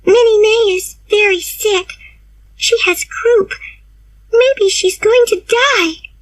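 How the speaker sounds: put-on high, urgent girlish plea. A narrator's reading. Diana bursting in for help, high urgent plea. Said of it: "she said".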